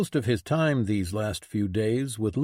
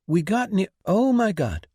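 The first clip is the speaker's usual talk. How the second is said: shocked; 8 semitones higher, 4 dB louder than usual. Acted. Walks in on couple undressed.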